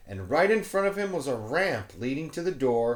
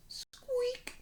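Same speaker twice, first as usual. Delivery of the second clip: very high, soft squeak; squeaky shelf; 8 dB quieter than usual. Acted. The shelf creaking as George leans on it, high squeak. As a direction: make it squeak like a cart wheel. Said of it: "went the shelf".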